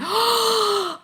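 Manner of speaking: long, high-pitched gasp; big high intake of breath; excited gasp. Real, not acted. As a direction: gasp in delighted surprise. News of a makeup convention.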